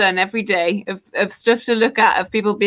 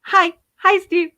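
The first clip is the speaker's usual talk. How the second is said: high, mock-eager child's hello; excited little child; 9 semitones higher, slower than usual. Child meeting a hero who turns out horrible, excited. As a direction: greet your hero like a thrilled kid. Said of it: "like a really excited little child".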